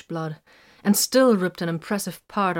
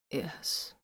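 soft unvoiced yes, about to crack; voice close to cracking; 10 dB quieter than usual. A narrator's reading. Wounded Vox admits it to Titus, near breaking, soft. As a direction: hold back the crack in the voice. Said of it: "his voice threatened to crack".

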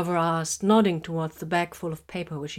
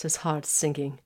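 ordinary delivery, nothing marked

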